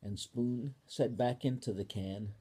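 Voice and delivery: ordinary delivery, nothing marked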